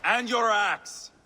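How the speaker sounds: high, slow jeering call; mocking shout. Brother jeers at the departing group, mock shout. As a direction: toss the jab after them, laughing at him.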